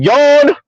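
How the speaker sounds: high mock yawn; a bored, high yawn. Mocking yawn after the quote.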